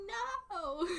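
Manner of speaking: mock-devastated, high-pitched protest; dismayed wail. Disappointed reaction to games not offered.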